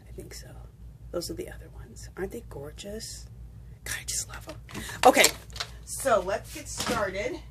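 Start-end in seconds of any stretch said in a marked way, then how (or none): 0.1-4.8 soft near-whisper, admiring the beads; hushed admiration. Real, not acted. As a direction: breathe it out softly, like you're in awe of them. Admiring her beads softly to camera.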